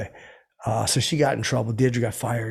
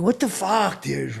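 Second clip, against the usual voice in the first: yelling; 8 semitones higher, slower than usual. Retold or imitated. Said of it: "I was, like, loud".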